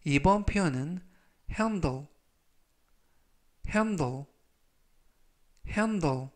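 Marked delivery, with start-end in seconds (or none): 1.5-6.3 handle said slowly and clearly, ending falling; teacher pronouncing handle for students; slow model pronunciation. Shown as a demonstration. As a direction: model the word handle carefully for repeating. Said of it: "이라고 발음하시면 되겠고요".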